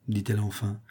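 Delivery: ordinary delivery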